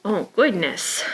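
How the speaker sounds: usual speaking voice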